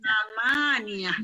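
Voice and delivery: "Samaniyah" with the middle "ma" drawn out; long MA. Shown as a demonstration. Teacher models number eight, long MA in "Samaniyah". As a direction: slowly say "Samaniyah", holding the syllable after the m. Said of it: "Dengan sa lagi dan mimnya panjang".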